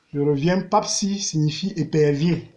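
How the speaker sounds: ordinary talk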